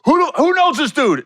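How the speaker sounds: loud, high mimicked outburst; going ham. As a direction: demand it of the whole room, hammed-up anger. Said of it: "ham on the dude".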